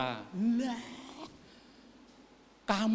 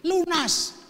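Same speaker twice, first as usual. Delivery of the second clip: high, loud smug "lunas"; husband brags his wife is paid off, high and loud; mock husband; 11 semitones higher, 8 dB louder than usual. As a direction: punch "lunas" like a debt settled.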